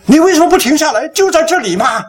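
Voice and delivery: high-pitched scolding complaint; mock angry wife. Wife scolding husband for not stopping, annoyed. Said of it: "她生气了".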